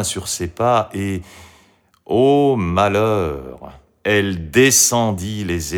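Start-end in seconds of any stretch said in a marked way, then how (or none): 2.0-3.8 slow, louder storyteller's woe; dramatic lament. A narrator's reading. Storyteller laments Marie turning back, slow dramatic woe. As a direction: draw out the cry of misfortune.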